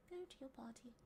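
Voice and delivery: soft whispered reading; whispering to oneself. Real, not acted. Streamer mulling over quiz options, whispering. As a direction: read it under your breath. Murmurs answer option while thinking.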